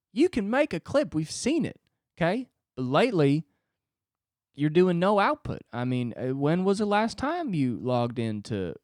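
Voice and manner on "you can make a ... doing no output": mildly higher, coaxing assistant coach; soothing assistant coach. Pretend. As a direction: talk him up, gently pushing. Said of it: "an assistant coach to come in and be like".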